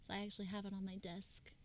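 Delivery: soft quick whisper. Real, not acted. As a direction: drop to a whisper for an aside. Aside about figurine on desk.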